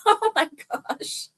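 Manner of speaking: high, slow, breaking into laughter; helpless exclamation after a laugh. Real, not acted. Woman recalling her painful mishap, laughing it off. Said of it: "I'm laughing about it now".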